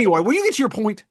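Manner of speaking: high, loud, hurried prod; exasperated interruption. Real, not acted. Co-host hurrying a rambling friend along, exasperated. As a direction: cut in on a rambling friend, impatiently.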